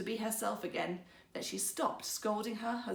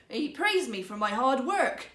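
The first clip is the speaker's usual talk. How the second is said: sly; 2 semitones higher, 7 dB louder than usual. A narrator's reading. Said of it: "said her husband slyly".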